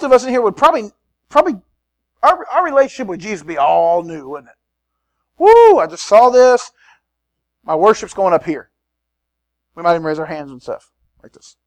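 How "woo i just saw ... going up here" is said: loud, slow whoop of delight; hammed-up thrilled churchgoer; excited worshipper. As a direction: cheer like you just saw a miracle.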